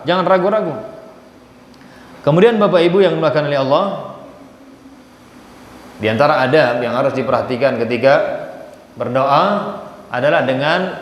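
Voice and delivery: usual speaking voice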